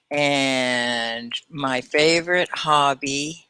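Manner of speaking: slow, drawn-out words with long gaps; slow and halting, composing aloud; slow dictation. Shown as a demonstration. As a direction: say it word by word as if typing it out. Slowly composing example answer while typing.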